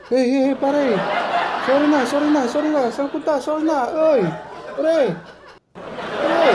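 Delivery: high, pleading apologies called after a friend; pleading sorry. Acted. Friend begging his angry buddy to come back, high pleading. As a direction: chase him down, begging forgiveness.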